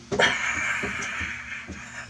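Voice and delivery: drawn-out voiceless mock scream; imitated screaming. Captured creature out of its mind, mock screaming. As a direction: imitate a creature going berserk. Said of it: "just screaming".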